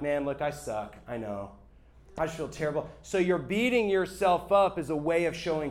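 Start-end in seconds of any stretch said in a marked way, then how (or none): 0.0-2.9 self-pitying sigh. Retold or imitated. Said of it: "that tone of like".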